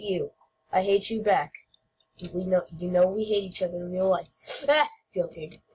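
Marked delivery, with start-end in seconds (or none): none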